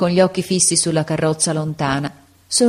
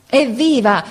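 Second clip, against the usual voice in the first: high, moderately raised cheer; a narrator's contained hurrah, pitched up; cheering shout; 7 semitones higher, 3 dB louder than usual. A narrator's reading. Soldiers cheer their prince in battle, high contained shout. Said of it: "noi gli gridammo".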